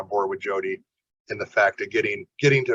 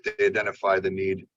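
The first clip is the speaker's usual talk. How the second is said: ordinary delivery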